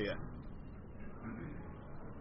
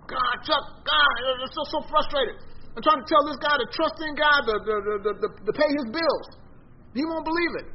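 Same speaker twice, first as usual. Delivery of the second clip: mock frustration; 9 semitones higher than usual. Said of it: "he just got so frustrated".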